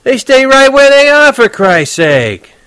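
yelling. Acted. Horwitz yelling at Holden from the front seat. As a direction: yell back at a dumb question. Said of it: "he yelled at me".

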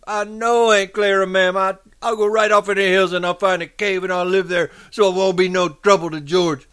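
high, loud, distressed moaning; grieving moan. A narrator's reading. Lenny promising his aunt to leave, high grieving moan. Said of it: "Lenny moaned with grief".

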